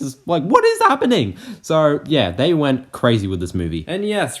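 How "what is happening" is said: high, loud mock disbelief; bewildered outburst. Viewer unsure whether to be scared or laughing, loud bewilderment. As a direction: exclaim it in exaggerated, baffled alarm.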